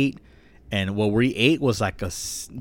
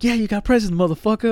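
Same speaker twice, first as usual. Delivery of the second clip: put-on much higher, louder goofy British boy; mock Ron voice; 14 semitones higher, 7 dB louder than usual. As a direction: squeal it like an excited kid. Voicing Ron in retold scene.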